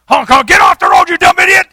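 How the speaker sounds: furious high shout at a driver; road rage yell. Acted. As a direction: bellow it like an enraged driver. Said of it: "That almost came out naturally".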